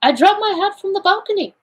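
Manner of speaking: high-pitched, artless exclamation; Poirot exclaiming simply. A narrator's reading. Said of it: "exclaimed Poirot simply".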